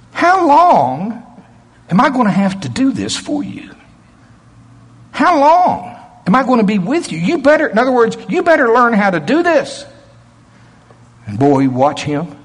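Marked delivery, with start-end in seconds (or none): 0.1-9.9 Jesus rebuking his disciples, exasperated and angry; angry rebuke. Shown as a demonstration. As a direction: scold them for their faithlessness. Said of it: "some temper in his voice".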